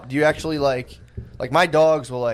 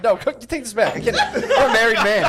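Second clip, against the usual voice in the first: laughing protest; 8 semitones higher than usual. Real, not acted. Laughing refusal to hold leash.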